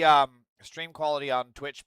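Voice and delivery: usual speaking voice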